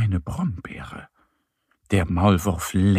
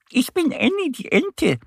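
very high-pitched little duck; tiny high duck voice; Annie. A narrator's reading. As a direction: introduce yourself in a high duck voice. Narrator voices duck introducing herself.

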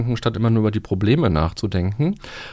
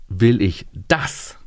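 slow question landing hard on "das"; "das" set apart and stressed, rising; 2 semitones lower, much slower than usual. Shown as a demonstration. Coach showing the second way to ask, stress on "das". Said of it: "Die Betonung darauf".

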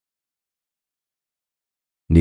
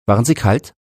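higher-pitched question, slightly raised; 7 semitones higher, faster than usual. A narrator's reading. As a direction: ask it aloud as the sick boy. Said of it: "fragte er laut". (etwas lauter, hoch gefragt)

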